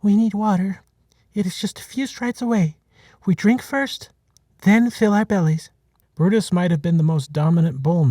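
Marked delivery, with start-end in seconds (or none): 0.0-5.7 higher, commanding herd mother; rally the herd, pitched up; addressing the herd. A narrator's reading. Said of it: "Henrietta addressed the herd".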